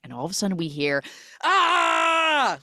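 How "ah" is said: high, loud male scream; mock horror-film scream. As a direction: scream like a man stabbed. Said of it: "like a male scream".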